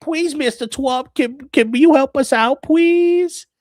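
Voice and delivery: very high, slow begging voice; pleading whine. Retold or imitated. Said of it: "Carney's like".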